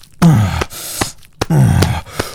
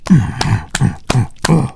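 ordinary delivery

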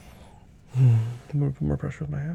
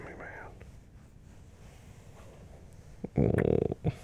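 hushed caring whisper. Acted. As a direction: breathe it gently to her. Whispered comfort throughout.

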